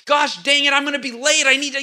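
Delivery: high, loud, exasperated griping; mock frustrated outburst. Driver in traffic venting at the jam, loud mock frustration. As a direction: act out losing it in traffic. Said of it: "I'd get all upset".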